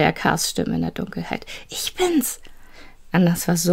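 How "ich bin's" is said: high, quick reassurance in the dark; a child's high call out of the dark; relieved call. Acted. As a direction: pitch it up, it's only me.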